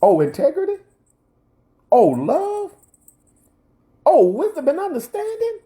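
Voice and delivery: a lightly voiced taunting devil; the enemy sneering at the preacher's values; mocking enemy. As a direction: scoff at each value in a high mock voice. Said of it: "The enemy says".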